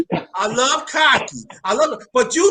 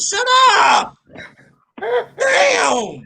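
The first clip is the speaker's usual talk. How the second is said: mock shout; 9 semitones higher, much slower than usual. Mock rant at absent Zach.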